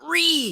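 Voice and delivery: sudden high yelp of Re; mock screech. Podcaster imitating the streamer's sudden Re screech. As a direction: squeal the word Re like a meme. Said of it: "the soy jack screeching".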